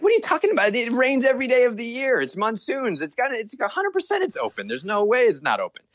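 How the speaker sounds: high, disbelieving protest; can't believe what he's hearing; incredulous. Retold or imitated. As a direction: tourist scoffing at the rain excuse. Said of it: "And I'm like".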